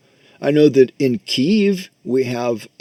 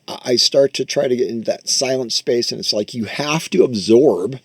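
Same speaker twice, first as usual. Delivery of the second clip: ordinary talk, nothing marked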